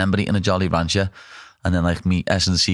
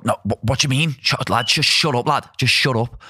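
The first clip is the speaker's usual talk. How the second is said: higher, mock irritable snap; ratty snap; 5 semitones higher than usual. Hungry fighter mock snapping at friends, ratty. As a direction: bite his head off, half in jest. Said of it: "I get very ratty".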